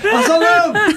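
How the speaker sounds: laughing, high thrilled cry; laughing mock triumph; excited boyish yell. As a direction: blurt it like a kid who finally saw.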